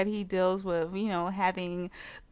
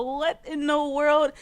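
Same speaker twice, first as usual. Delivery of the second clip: usual speaking voice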